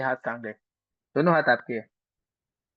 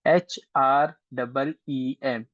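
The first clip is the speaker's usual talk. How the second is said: spelled out slowly; 2 semitones lower, much slower than usual. Shown as a demonstration. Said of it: "एच silent रहेगा".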